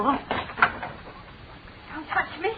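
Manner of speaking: slow, breathless whisper of fear; frightened whisper. Acted. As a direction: whisper it, terrified. Frightened girl in dark room.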